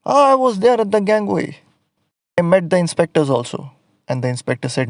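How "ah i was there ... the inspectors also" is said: high, loud put-on workman voice; the same put-on painter, eager to excuse himself; painter's voice. Man on radio answers captain.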